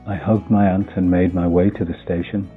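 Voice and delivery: ordinary delivery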